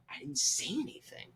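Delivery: high, unvoiced mock whisper; hushed lament. Fan who froze before a celebrity, in a high hushed whisper. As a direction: whisper it in high, soft disbelief. Mock regret at staying silent.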